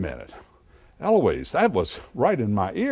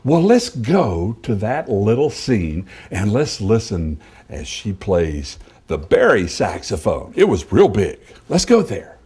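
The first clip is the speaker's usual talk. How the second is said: put-on kids show host; 5 dB louder than usual.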